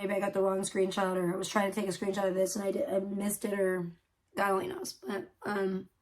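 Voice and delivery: ordinary talk, nothing marked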